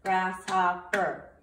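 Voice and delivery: each syllable drawn out slowly; slow syllable count. Shown as a demonstration. Teacher showing students how to count syllables, slow and even. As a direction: sound out every syllable slowly. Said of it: "just like we would do if we clapped it".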